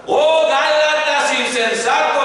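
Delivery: high, loud exclaimed cry; shouting the verse. Shown as a demonstration. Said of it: "como gritando".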